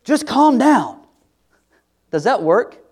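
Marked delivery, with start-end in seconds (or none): 0.0-1.0 high, loud, exasperated snap; snapped calm down. Retold or imitated. Scene: arguing spouse told to calm down, sharp snap. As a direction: bark it at a heated partner. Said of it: "you said something like".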